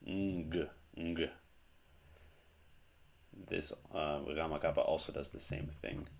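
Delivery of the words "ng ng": ng hummed into a hard g; isolated ng released as "nguh"; ng-g sound. Shown as a demonstration. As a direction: say ng, nasal then g. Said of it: "it makes the sound of".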